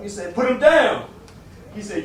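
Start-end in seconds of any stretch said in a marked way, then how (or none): 0.3-1.0 sharp order. Retold or imitated. Keeper orders at gunpoint.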